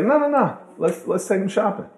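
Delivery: a high, excited voice for Pookie; eager Pookie. Retold or imitated. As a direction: jump in to agree with a grin.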